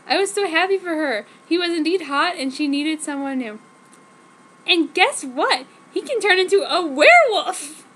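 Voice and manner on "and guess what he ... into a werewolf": excited gossip. Acted. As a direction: tease the big secret gleefully. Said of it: "she said all existedly".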